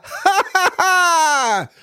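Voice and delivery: high, slow sinister laugh; evil cackle. Acted. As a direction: cackle like a witch. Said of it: "Она зловеще захохотала". (зловещий хохот)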